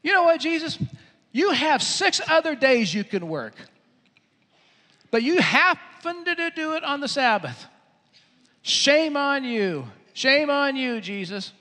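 high-pitched indignant scolding; hammed-up outrage, telling someone off; mad. Onlookers scold Jesus for Sabbath work, acting mad. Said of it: "everybody else was being mad".

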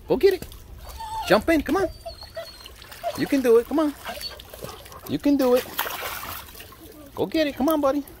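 high-pitched dog coaxing; sing-song encouragement to a pet; high coaxing call. Real, not acted. Urging dog into pool.

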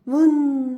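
loud blown wind noise; wind whoosh. Acted. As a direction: blow it like a gust. Wind blows the letter.

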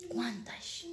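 breathy whispered quantas; whispered question. Real, not acted. Speaking of betrayed wives, a hushed whispered question. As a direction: let it drop to a whisper.

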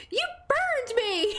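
high, hammed-up indignant cry; mock outrage. Retelling getting burned by the griddle, mock outraged cry. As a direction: replay the outrage with a grin. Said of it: "I was like".

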